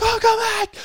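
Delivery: high, loud frightened yell for the uncle; panicked call. Retold or imitated. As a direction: shout for help as a scared kid. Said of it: "I'm like".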